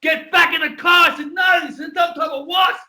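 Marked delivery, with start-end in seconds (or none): 0.0-1.1 high, loud order, slowing at the end; cop's command shout. Retold or imitated. Cop ordering again. 1.3-2.8 retold insistence shouted at the cop; insistent shout.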